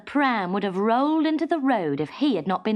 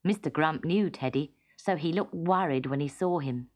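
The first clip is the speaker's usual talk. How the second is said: ordinary delivery, nothing marked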